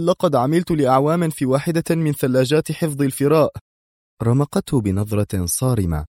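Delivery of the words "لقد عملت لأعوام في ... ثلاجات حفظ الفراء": high, anxious explanation; pleading high voice; urgent exclamation. A narrator's reading. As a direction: hurry to justify yourself. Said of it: "فأكمل هاتفا".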